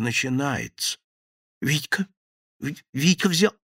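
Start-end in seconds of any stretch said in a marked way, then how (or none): 1.6-3.5 stammering fear. A narrator's reading. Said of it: "ужас охватил Шеренца".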